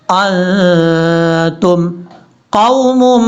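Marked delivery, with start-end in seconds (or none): 0.1-1.9 अंतुम with a hidden, nasal n; ikhfa with ghunna. Shown as a demonstration. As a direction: hum the n of अंतुम into the t. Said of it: "इख्फा करेंगे, गुन्ना". (अंतुम में इख्फा और गुन्ना)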